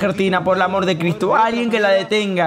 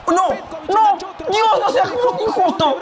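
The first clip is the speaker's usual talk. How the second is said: grito desesperado; 9 semitones higher, much slower than usual. Real, not acted. Grita a Dios por el partido.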